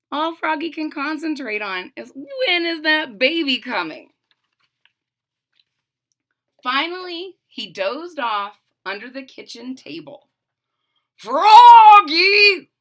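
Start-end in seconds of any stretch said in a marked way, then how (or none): 2.1-4.1 high, loud, impatient whine; can't wait any longer. Acted. Froggy whines about the baby, high and impatient. 11.2-12.7 very loud high shout; calling out. Acted. Father shouts for Froggy to come see, loud. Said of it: "called his father".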